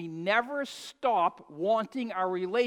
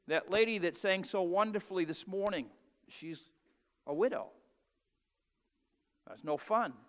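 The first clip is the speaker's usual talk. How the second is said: ordinary delivery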